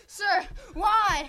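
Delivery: loud, high-pitched pleading cry; desperate plea. Acted. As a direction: cry out to the teacher attacking you. Boy pleads with attacking teacher.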